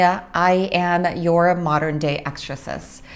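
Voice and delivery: ordinary delivery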